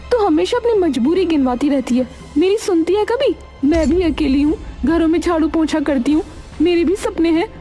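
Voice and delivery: high-pitched, indignant complaint; aggrieved creditor friend. Acted. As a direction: protest your own hardship to a friend. Friend complains about the debt.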